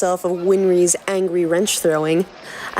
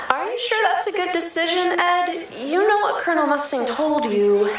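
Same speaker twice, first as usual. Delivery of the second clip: worried Al; 9 semitones higher than usual. Acted. Narrator voicing Al warning his brother, high and worried. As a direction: plead gently, a little anxious.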